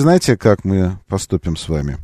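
ordinary talk, nothing marked

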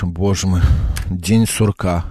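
low, resigned waking-up groan of words; weary dread. Acted. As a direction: sigh it as another identical morning. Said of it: "просыпаешься и думаешь".

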